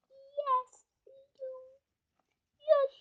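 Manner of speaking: high toy crocodile voice; crocodile puppet voice. Acted. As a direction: squeak out the toy crocodile's answers.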